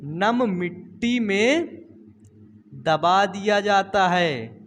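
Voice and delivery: very slow, word by word for notes; slow dictation. Shown as a demonstration. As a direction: spell it out slowly so students can write. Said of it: "कहो किसमें?". (धीमे-धीमे लिखवाते हुए)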